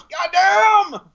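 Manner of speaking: mock stunned cry; imitated exclamation. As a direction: blurt it out like a gruff actor. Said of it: "Michael Rooker goes".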